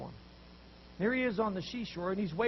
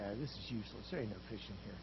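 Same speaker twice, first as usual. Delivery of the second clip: lower, softer disgusted grumble; a low, softer sulky complaint; grumbling quitter; 8 semitones lower, 9 dB quieter, faster than usual. Retold or imitated. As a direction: mutter it like an impatient fisherman. Impatient fisherman giving up.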